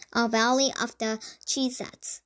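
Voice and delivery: ordinary delivery, nothing marked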